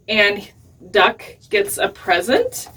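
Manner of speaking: usual speaking voice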